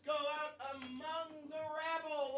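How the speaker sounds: a hammed-up ringmaster heading into the rabble; mock grand announcer. Host addressing the audience as a mock ringmaster.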